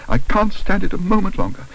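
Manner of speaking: high, overwrought protest; distressed. A narrator's reading. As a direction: say it at the end of your nerves. Mrs Brown distressed outside.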